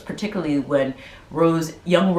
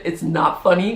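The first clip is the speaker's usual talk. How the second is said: ordinary talk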